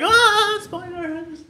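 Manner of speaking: high, loud mock cry of panic; hammed-up alarmed shriek. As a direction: cry out like fleeing viewers. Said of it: "They were like".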